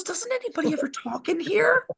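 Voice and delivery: high, put-on voice of a bored player; a lady sighing into an empty chat; mocking the woman. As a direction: pitch it up and sound plaintive. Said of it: "she goes".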